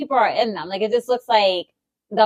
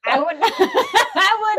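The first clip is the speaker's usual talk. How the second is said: slow, higher, breaking up with laughter; laughing; 9 semitones higher, much slower than usual. Real, not acted. Co-host joking about kicking down the door, laughing. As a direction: try to get it out through laughs.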